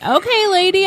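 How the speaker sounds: high-pitched retold placating; mock placating. Shopper answering a screaming stranger, mock placating. Retelling her reply to screamer.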